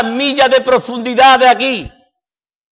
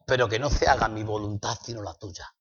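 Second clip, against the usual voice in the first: soft, humble prayer to God; a hushed, trusting plea; 10 semitones lower, 13 dB quieter than usual. Retold or imitated. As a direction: pray it quietly, surrendering your will. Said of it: "O le dices".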